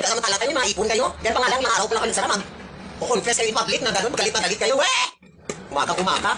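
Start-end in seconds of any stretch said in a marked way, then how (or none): 4.7-5.0 sharp high scoffing yelp; mocking "weh". Real, not acted. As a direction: scoff at them in disbelief. Mocking the artists' complaints. (pang-uuyam na sigaw)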